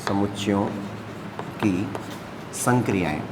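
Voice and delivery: dictating a heading to students, word by word. Shown as a demonstration. Spells out title while writing.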